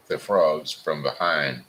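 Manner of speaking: ordinary delivery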